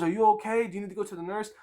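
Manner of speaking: high, fussing worried voice; imitating bus driver. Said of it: "And she goes".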